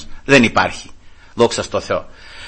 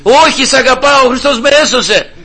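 joyful insistence; 10 semitones higher, 5 dB louder than usual. Retold or imitated. Father insisting warmly.

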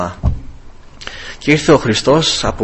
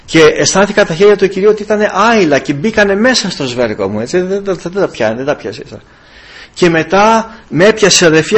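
usual speaking voice

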